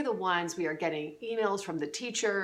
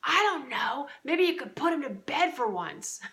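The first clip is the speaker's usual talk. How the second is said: mock exasperated request; exasperated wife; 7 semitones higher than usual. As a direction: snap at him, fed up. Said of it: "we'll say to you".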